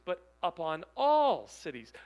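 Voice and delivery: ALL hit hard and loud; stress on all. Shown as a demonstration. Preacher stressing that all cities are meant, punching ALL. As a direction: lean into the word all. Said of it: "that little word all is pretty inclusive".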